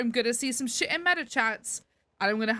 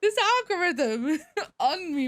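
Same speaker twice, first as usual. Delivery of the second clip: laughing; 7 semitones higher, slower than usual. Real, not acted. Laughing about the algorithm.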